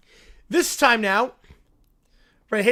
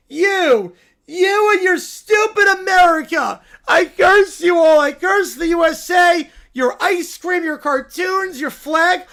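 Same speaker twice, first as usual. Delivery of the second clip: put-on much louder, higher kid shouting curses; furious cursing tantrum; 4 semitones higher, 6 dB louder than usual. Caillou cursing the whole USA, furious shouting. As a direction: hurl the curse like a raging toddler.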